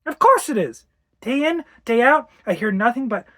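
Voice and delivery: high, loud exasperation; exasperated outburst. Acted. Wife complaining to husband about Harry, exasperated. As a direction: let pent-up frustration burst out.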